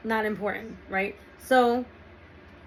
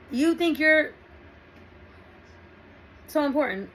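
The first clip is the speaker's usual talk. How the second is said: mock tantrum, voice pushed up high; overreacting rant; 5 semitones higher than usual. Girlfriend overreacting to her boyfriend with a high angry rant. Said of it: "yelling and screaming of some sort, overreacting".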